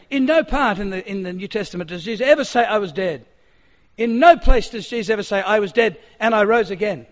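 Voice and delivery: loud, emphatic, crowd-rousing claim; confident debater. Retold or imitated. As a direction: drive each denial home like a powerful debater. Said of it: "it was very confident".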